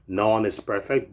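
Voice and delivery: ordinary talk, nothing marked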